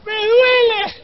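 hammed-up high, drawn-out squeal; pained wail. Aching runner answering his impatient teammate, pained wail. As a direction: wail it very high like a comic sufferer.

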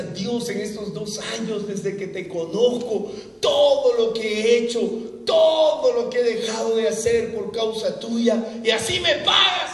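loud, high, angry retold prayer; young man telling God he'll get drunk, mock-furious and loud. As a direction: rail at God in mock fury. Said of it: "estaba con furia con Dios".

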